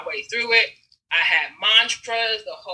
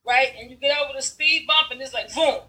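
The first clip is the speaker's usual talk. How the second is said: usual speaking voice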